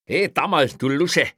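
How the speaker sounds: high, loud jeering shout; calling out. A narrator's reading. Policeman shouts at a bungling burglar across the office, jeering. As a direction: yell the taunt at him. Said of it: "rief Kreutner dem Mann zu".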